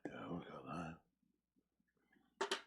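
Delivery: soft unvoiced whisper. Real, not acted. Preacher whispering an aside off to the side. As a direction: breathe it, no voice at all. Aside during a long pause.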